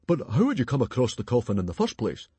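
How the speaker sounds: big bluff chieftain; booming chief. Acted. Stoic quizzing Alvin, loud and hearty.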